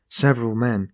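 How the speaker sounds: ordinary delivery, nothing marked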